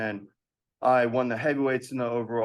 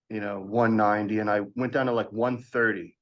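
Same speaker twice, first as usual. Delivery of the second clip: usual speaking voice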